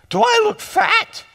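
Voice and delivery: high-pitched mock wife voice; hammed-up needy question; imitated worried wife. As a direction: pitch it right up, pleading for an answer. Imitates anxious pregnant wife's question.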